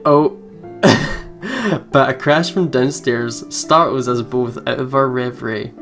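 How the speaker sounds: normal talking voice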